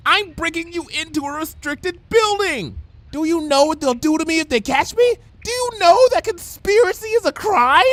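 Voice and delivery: rising panic, barely holding on; panicked Bon Bon. A narrator's reading. Bon Bon scolding Lyra, frantic and high. Bon Bon's voiced lines.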